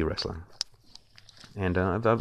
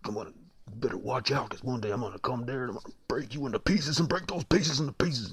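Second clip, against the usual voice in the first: wrestling promo; 6 semitones higher than usual. Shown as a demonstration. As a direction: growl it like a wrestler hyping a fight. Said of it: "they'd be like".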